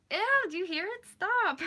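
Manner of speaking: loud, high-pitched squeal of "ew"; grossed-out outburst. Real, not acted. Vlogger reacting to stomach rumbles, grossed-out squeal. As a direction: squeal at your own body to stop. Interrupted by own stomach noise.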